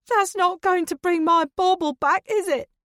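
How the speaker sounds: high, loud, furious shriek; very high, loud, enraged outburst; storming off. A narrator's reading. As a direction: hurl it as you storm out. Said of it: "Dawn storms out of the room".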